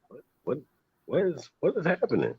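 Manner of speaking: high-pitched disbelief; incredulous. Real, not acted. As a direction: ask it in pure shock. Mock disbelief at the topic.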